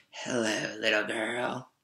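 low-key, softened growly greeting; deep, growly wolf. A narrator's reading. Hidden wolf greeting a little girl, deep and growly. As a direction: growl it softly, like a lurking wolf. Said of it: "said a deep, growly voice".

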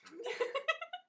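bursts out in a squealing laugh; high shrieking laugh; loud high laugh. Real, not acted.

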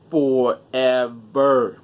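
very slow, stretched emphasis; drawn out. Real, not acted. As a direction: stretch the word out. Repeats word for emphasis.

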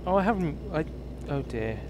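normal talking voice

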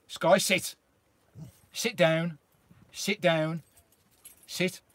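firm dog command. Real, not acted.

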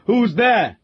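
wild frightened call. A narrator's reading. Squire calling out to an unseen intruder in fear. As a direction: cry out like a man who expects a robber. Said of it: "the squire wildly called".

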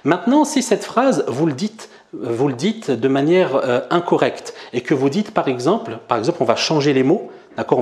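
normal talking voice

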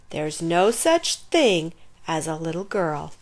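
usual speaking voice

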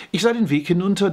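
ordinary delivery

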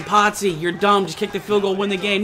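normal talking voice

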